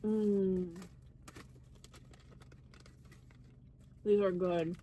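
talking while eating sweets; chewing. Real, not acted. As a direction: mouth full of chocolate. Seen 3: Bit a truffle at -4; mouth closed, chewing through the labelled words.

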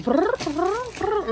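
high rolled brrr like a purring cat; playful purr imitation.

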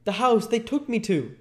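put-on very high, urgent boy's voice; frightened Oliver. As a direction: blurt it out as an alarmed child. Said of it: "whispered Oliver".

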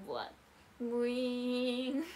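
slow buzzing shaver imitation; playful vroom of a shaver; shaver noise. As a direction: mimic an electric razor.

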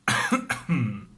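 loud smoker's cough; coughing fit. Real, not acted. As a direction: cough hard, a heavy smoker's cough. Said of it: "Çok sigara içiyoruz, böyle oluyor".

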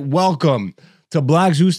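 normal talking voice

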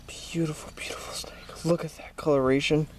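hushed, admiring whisper; excited wildlife host whisper. Real, not acted. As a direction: whisper it in awe at the snake. Admiring snake up close.

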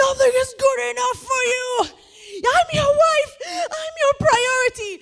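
high, wailing sobbed yelling; crying shout. Retold or imitated. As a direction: bawl out every grievance. Wife's tearful comeback in the fight.

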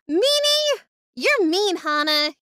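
high, loud mock tantrum; playful indignant whine; childish accusation. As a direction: yell like a wronged little kid.

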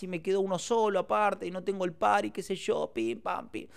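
mock plaintive moan, going on and on; retold downcast mother. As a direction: imitate a mother fretting over her loss. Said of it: "la encuentro a mi vieja media cabizbaja".

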